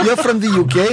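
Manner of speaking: high-pitched mock British accent; bad British accent. Mocking a friend's claim to be British, hammed-up British accent. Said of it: "is Sal's British accent".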